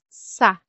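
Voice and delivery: "sa" with a soft s sound. Shown as a demonstration. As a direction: sound out "sa" with s in place of k. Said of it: "will be soft S".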